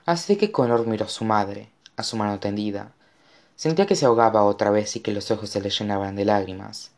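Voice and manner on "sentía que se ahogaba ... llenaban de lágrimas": ordinary delivery, nothing marked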